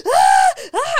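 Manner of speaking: high loud mock toddler scream of fright; imitated scared scream. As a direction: shriek like a scared little girl clinging on. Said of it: "She's like".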